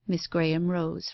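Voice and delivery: ordinary delivery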